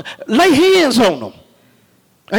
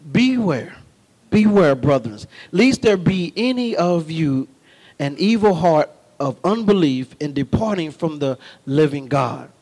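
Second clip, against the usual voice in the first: scripture reading; 6 semitones lower than usual. Acted. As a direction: read the warning from Hebrews. Reads a scripture quote.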